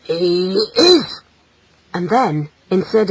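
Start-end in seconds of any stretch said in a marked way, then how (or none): 0.0-1.2 grim fatherly growl; growling, emphatic confirmation. Acted. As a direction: growl out the verdict. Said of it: "growled her husband".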